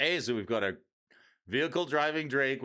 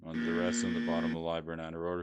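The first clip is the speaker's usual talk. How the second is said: ordinary delivery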